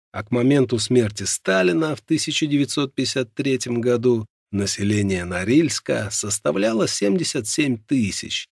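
ordinary delivery, nothing marked

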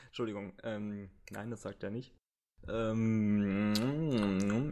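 ordinary talk

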